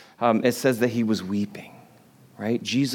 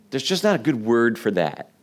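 ordinary talk

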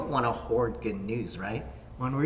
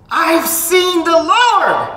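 very high, loud, slow shout; yelled announcement; 17 dB louder, much slower than usual. Acted. Mary announcing to the disciples, a slow ringing yell. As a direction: proclaim it like a wake-up yell. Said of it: "sometimes pastors yell".

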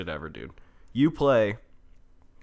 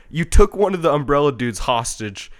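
usual speaking voice

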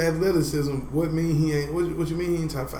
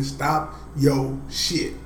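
slow scolding; much slower than usual. Real, not acted. Drawn-out scolding of friend.

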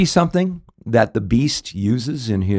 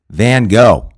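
clue called out; 8 dB louder than usual. Retold or imitated. Celebrity giving the clue.

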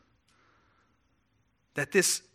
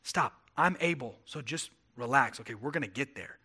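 softer, lower, calming and steady; reassuring God; 4 semitones lower, 6 dB quieter than usual. Retold or imitated. God calming anxious Moses, softer and lower. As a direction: settle a frightened man down gently. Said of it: "you see God saying".

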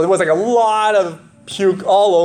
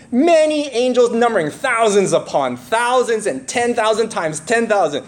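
lifted, higher reading voice; scripture reading; 6 semitones higher than usual. Acted. Pastor reading Revelation to the church, lifted and proclaiming. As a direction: proclaim the verse to the congregation.

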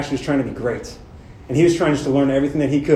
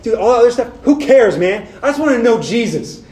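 higher, louder, driving preacher's voice; full-force preaching; 7 semitones higher, 5 dB louder than usual. Acted. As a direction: preach it out to the room, rising and urgent. Preaching Paul's voice.

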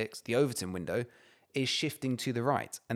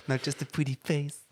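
normal talking voice

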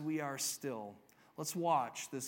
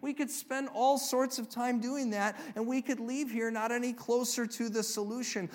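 usual speaking voice

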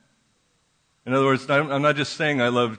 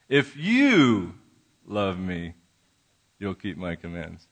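"If you" raised, the rest hushed and low; stress on IF YOU; 5 semitones lower, slower than usual. Shown as a demonstration. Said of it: "you emphasize a certain word".

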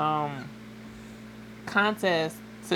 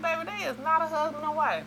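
high retold voice; 10 semitones higher, 3 dB quieter, faster than usual. Correcting mom.

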